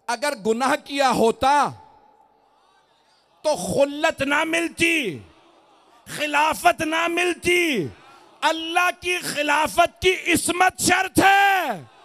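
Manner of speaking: high, slow, ringing shout; full-force preaching. Real, not acted. Preacher argues Adam's sinlessness to the congregation, shouting at full force. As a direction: hurl each clause out and hold its last word.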